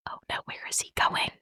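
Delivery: hushed worried whisper; fast anxious whisper. Retold or imitated. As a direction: breathe it out as a nervous whisper. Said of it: "and you're like".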